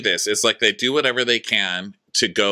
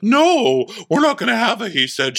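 much higher, louder firm no; refusing housewife; 13 semitones higher, 4 dB louder than usual. Retold or imitated. Robin refusing Karen's order, high firm mock retort. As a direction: cut her off high and firm.

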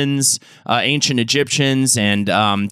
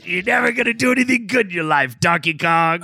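mock Cranky Kong bruiser taunt; 2 semitones higher than usual. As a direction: play a cranky old ape trash-talking. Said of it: "a Cranky Kong bruiser".

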